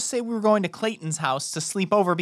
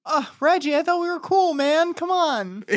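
high, wheedling appeal; hurt, coaxing protest; pleading protest; 6 semitones higher than usual. Acted. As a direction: appeal to a buddy who let you down. Pleads with the clerk in character.

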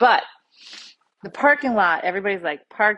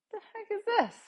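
a sudden what-on-earth, pitched way up; startled outburst; 13 semitones higher, 5 dB quieter than usual. Retold or imitated. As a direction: voice jumps high, thrown out in disbelief. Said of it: "So I say".

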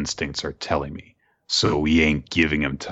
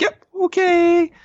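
very high, slow, louder cartoon voice; squeaky drawn-out parody voice; mock Chelsea; 6 dB louder, slower than usual. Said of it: "before she's like".

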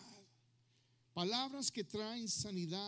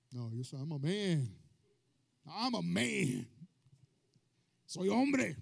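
mock tough guy insisting he's a man, louder and slower; macho man act; 3 semitones lower, 6 dB louder than usual. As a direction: puff up like a proud man. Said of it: "Los hombres".

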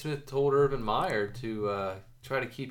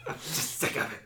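mock rant; 4 semitones higher, 8 dB louder than usual. Echoing the mock rant louder.

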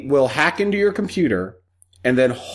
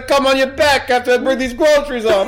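loud, much higher hammed-up wise guy; Andrew Dice Clay; 11 semitones higher, 4 dB louder than usual. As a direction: catcall her like a Jersey mobster. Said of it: "He was Andrew Dice Clay".

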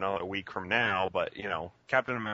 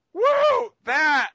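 much higher, much louder whoop; mock yell; 11 dB louder than usual. Imitating the heckler cheering an unknown trailer, loud. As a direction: whoop at nothing like a clueless fan. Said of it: "the guy goes".

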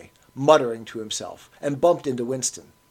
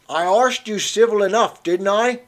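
put-on higher, louder old Cockney, squaring up; a touchy old man demanding his due; pugnacious old Cockney; 8 semitones higher, 7 dB louder than usual. A narrator's reading. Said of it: "straightening his shoulders pugnaciously".

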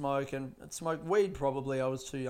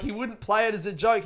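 ordinary talk, nothing marked

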